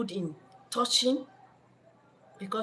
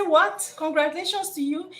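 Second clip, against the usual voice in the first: higher, incredulous echo; mock-shocked disbelief; shocked girlfriend; 8 semitones higher than usual. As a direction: throw his words back at him. Woman shocked by his reply.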